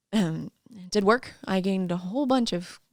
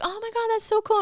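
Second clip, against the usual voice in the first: much higher, gushing oh my God; mock impressed gush; 14 semitones higher than usual. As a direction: squeal it like an impressed fan. Quoting impressed people.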